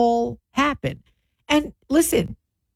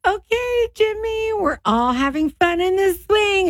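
forced upbeat parent; 10 semitones higher than usual. Retold or imitated. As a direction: play cheerful so the kid won't catch on. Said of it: "Try to keep him upbeat".

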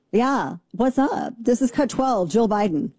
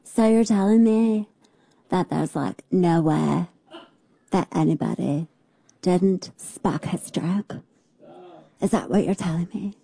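mocking vocal fry; 2 semitones lower than usual. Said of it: "I feel like I should be her".

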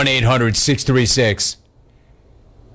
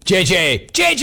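calling out; 4 semitones higher than usual. Real, not acted. Host calling out to caller.

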